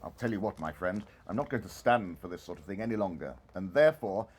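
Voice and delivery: low, gruff feigned reproach; mock stern boss. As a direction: pretend to scold the late clerk. Scrooge keeps mock sternness.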